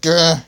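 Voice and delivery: a short loud gasp of pain; pained yell. Acted. Sliver McGiver hit in the fight, short loud pained yell. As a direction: cry out as the blow lands. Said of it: "yelled Sliver McGiver".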